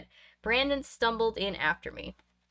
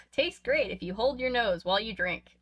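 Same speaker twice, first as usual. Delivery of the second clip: plain reading voice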